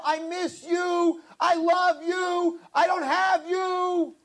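slow, heartfelt prayer; tender plea. Acted. Worshipper pleading to Hashem, slow tender longing. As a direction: linger on each word as if aching.

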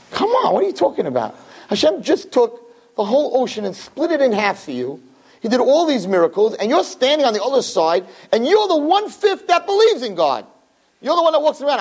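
preacher rebuking the doubters, incredulous and high; exasperated. Real, not acted. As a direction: scold them in disbelief.

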